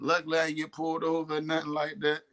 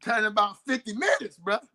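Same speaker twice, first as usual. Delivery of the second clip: normal talking voice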